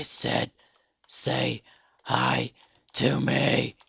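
insistent yelling at someone ignoring you; mock angry demand. Demanding a greeting, getting no reply.

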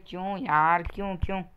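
ordinary delivery

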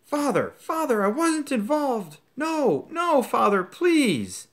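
very high, loud, terrified begging; desperate pleading. Acted. Son begging his father while dragged away, very high and terrified. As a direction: beg your father for mercy.

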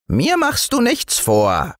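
high, unswayed little gnome voice; tiny, sure-of-himself dwarf; undeterred dwarf. A narrator's reading. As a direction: brush off the boy's protest in a piping voice. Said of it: "fuhr der andere unbeirrt fort".

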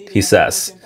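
ordinary delivery

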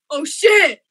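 a sudden high yelp of alarm; startled shout. Real, not acted. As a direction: shout it as the danger hits. Panic in a game.

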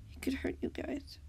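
soft, half-voiced worry; barely voicing his fear; quiet admission. A narrator's reading. Beat-Up quietly admits fear to Jem, soft and hushed. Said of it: "Beat-Up admitted quietly".

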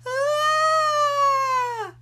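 shrill high shriek; scream. Acted. Oliver shrieking at the bigger fur ball. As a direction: let out a cartoon scream of horror.

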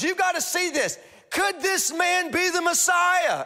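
breathless awe, pitch way up; excited woman. Retold or imitated. Woman asking the town about the Messiah, high and amazed. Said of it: "But she goes".

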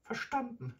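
small, very high, meek answer; lisping grandmother. A narrator's reading. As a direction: lisp it obediently with a falling tune. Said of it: "lispelte Großmutter".

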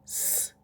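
"С" as a long voiceless hiss; sustained hissing "С"; isolated sound. Shown as a demonstration. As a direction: hold a pure "С" sound, no vowel. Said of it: "Який перший звук?".

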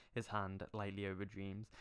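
ordinary talk, nothing marked

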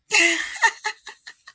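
high-pitched burst of laughter. Real, not acted. Laughing at the little girl's advice, high giggling laugh.

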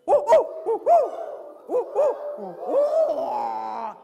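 loud, high hoots with a long falling last note; barred owl hoot. Shown as a demonstration. Handler demonstrating the barred owl's crazy call, loud high hooting. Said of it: "Okay, bards".

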